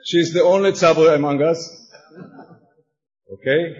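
usual speaking voice

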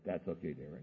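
very soft, low fatherly murmur; quiet, loving acceptance; tender reassurance. Retold or imitated. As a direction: gently soothe the child, understated. Father reassuring daughter again.

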